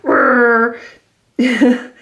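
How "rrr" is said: a short, playful mock growl; growling for the kids to copy; angry growl. Shown as a demonstration. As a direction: growl it through the teeth like an angry cartoon. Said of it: "if you make that sound".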